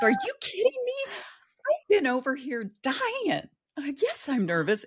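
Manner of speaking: high, incredulous cry of nerves; mock exasperation. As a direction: squeal it like you've been terrified. Her own panicked reply retold.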